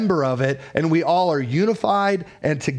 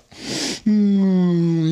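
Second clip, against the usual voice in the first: sleepy groan; 3 semitones higher than usual. Retold or imitated. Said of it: "He's like".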